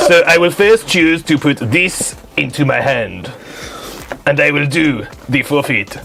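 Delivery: measured mock-French accent. Choosing a card for his hand, put-on French accent. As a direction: explain the move as a comic Frenchman. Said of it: "it's still gotta do the French accent".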